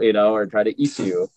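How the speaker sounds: normal talking voice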